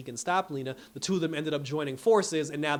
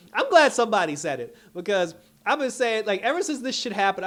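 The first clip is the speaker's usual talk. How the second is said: loud, high excited rant; 8 semitones higher, 9 dB louder than usual. Real, not acted. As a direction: blurt it out excitedly. Excited outburst agreeing.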